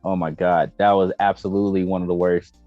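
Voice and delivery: ordinary talk, nothing marked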